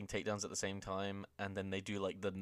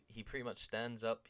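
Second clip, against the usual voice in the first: ordinary talk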